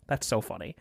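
quiet under the breath; inner voice. Retold or imitated. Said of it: "in my head, I'm like".

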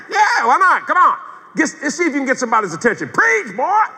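high, loud egging-on; acted shouting to get a preacher going; urging him on. Urges man to preach outside.

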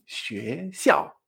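学 rising, 校 falling sharply; second-fourth tone pattern. Shown as a demonstration. Teacher sums up the pattern, 学 up and 校 down. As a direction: climb through 学, then drop 校. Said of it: "entonación dos cuatro".

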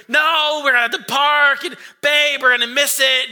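high, loud frantic protest; hammed-up panicked shout at partner; stressed-out yelling. Dad arguing with wife over parking, stressed-out shout. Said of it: "the stress is palpable".

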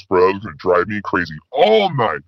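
ordinary talk

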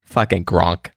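low, understated Boston name-drop; Boston accent. Acted. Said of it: "you do, you do that accent".

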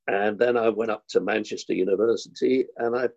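ordinary talk, nothing marked

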